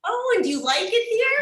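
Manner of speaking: high, loud mock-bright question; chirpy local asking. Imitating locals asking the foreigner, high and bright. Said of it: "And they're like".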